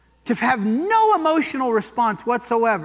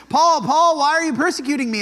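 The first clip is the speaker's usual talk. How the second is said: calling out; 5 semitones higher, 4 dB louder than usual. Retold or imitated. Jesus calling Paul on road.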